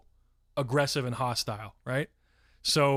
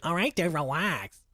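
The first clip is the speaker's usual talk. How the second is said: hammed-up cocky fifteen-year-old, higher; sassy teen; 4 semitones higher than usual. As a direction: play the cheeky teenager brushing him off. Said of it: "fifteen-year-old Kiko".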